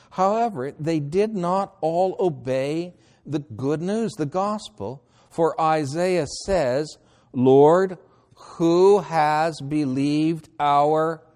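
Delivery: slow, emphatic Bible reading; ringing, deliberate scripture reading. Acted. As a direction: proclaim the verse with stretched, weighty words. Reads scripture aloud.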